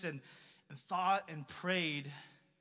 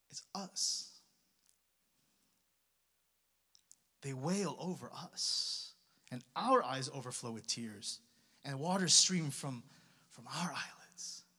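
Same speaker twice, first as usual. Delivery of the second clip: hushed sorrow; 6 semitones lower, 3 dB quieter than usual. Real, not acted. Pastor tells church God weeps with them, hushed. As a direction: soften into shared grief, almost whispered. Answers the accusation with shared grief.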